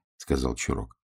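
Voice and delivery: plain reading voice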